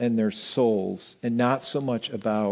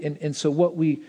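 ordinary talk

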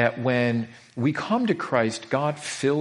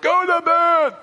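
much higher, louder mock yell of a dad; 7 dB louder, slower than usual. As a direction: bark it like a fed-up father at 3 a.m. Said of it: "My dad would be like".

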